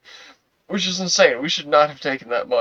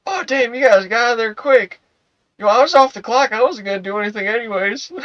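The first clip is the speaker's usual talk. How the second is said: mock cop voice; 9 semitones higher, 5 dB louder than usual. Cop calls out from car.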